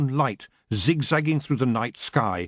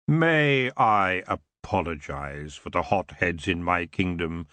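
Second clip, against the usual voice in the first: low and slow, silky; lazy, smooth elf king; 6 semitones lower, slower than usual. Acted. Elf king apologizing to Tiffany, lazy and smooth. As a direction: drawl it out smoothly. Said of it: "he continued lazily, his voice smooth".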